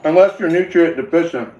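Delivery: talking through a mouthful of food; words muffled by chewing; mouth full. Real, not acted. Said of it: "I'm sorry, that was so gross".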